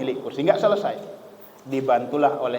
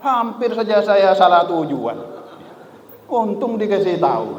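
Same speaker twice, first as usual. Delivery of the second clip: louder, higher mock indignant grumble; grumbling angrily; 8 semitones higher, 6 dB louder than usual. A passenger grumbling while he stands up to move. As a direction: huff it out as he stomps off. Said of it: "sambil marah-marah".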